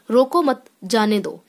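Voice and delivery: "रोको मत" as one unit, then "जाने दो"; pause after मत. Shown as a demonstration. Teacher showing how a comma changes meaning, pause after मत. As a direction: break after मत, keeping "रोको मत" together. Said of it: "अल्प विराम को हम मत के बाद".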